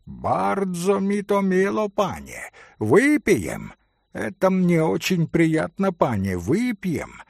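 a hint of a grand, condescending Polish gentleman; lightly voiced pan, gracious from above; lightly voiced Polish gentleman accepting the toast; pompous Polish pan. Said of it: "Важно и благосклонно проговорил пан".

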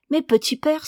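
put-on a small boy eager to come in; child crying out. A narrator's reading. Little boy at the door to his father, excited high call. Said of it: "s'écrie-t-il".

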